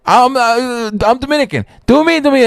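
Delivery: high pleading voice; eager customer. Retold or imitated. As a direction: squeal it out high, begging. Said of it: "everybody kept going".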